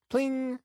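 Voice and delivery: high pinging sound effect; ping it out high like a game sound; a sudden high pling. A narrator's reading. Sound effect for monsters appearing.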